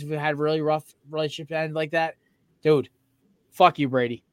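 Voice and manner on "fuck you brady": ordinary talk, nothing marked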